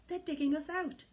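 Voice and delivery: high, loud panicked cry; alarmed shout. A narrator's reading. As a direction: raise the alarm. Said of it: "he shouted".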